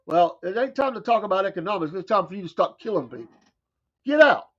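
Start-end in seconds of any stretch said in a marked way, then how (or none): 4.1-4.5 shouted. Real, not acted. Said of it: "telling envoy off".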